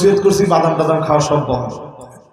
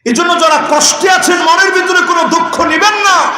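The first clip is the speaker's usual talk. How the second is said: high, shouted sermon call; fervent pulpit shout; preaching at full force; 11 semitones higher than usual. Acted. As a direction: cry it out to the whole gathering. Preacher raises voice to crowd.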